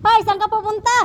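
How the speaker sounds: loud, high call after a runaway pet; hey, where are you going, called out loud. Real, not acted. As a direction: shout after it to stop it. Calling after a wandering pet.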